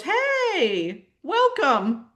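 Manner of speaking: sly ego. Acted. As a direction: greet her like a fake-friendly trickster. Ego greets her falsely.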